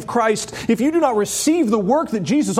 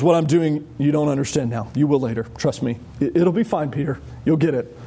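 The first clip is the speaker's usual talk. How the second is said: gentle reassurance; 6 semitones lower than usual. Retold or imitated. Preacher voicing Jesus reassuring Peter.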